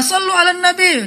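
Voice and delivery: menirukan seruan melerai, nada melengking tinggi; seruan salawat. Retold or imitated. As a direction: berseru tinggi untuk melerai yang marah. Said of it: "marah-marah". (menirukan seruan salawat bernada tinggi)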